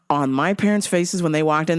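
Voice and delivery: ordinary talk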